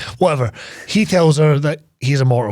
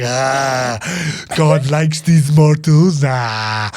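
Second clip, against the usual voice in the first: mock Kurgan sneer; much slower than usual. Mocking the Kurgan taunting the priest, slow gloating villain. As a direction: stretch it out like a gloating brute. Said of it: "the Kurgan's just like".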